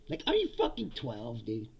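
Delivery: normal talking voice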